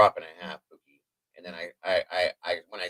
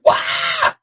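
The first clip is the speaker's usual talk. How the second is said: short, unvoiced mock scream; imitated startled yell. Man showing how he yelled at open cupboards, imitated yell. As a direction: imitate your own yelp of fright. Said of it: "I yelled like a bitch".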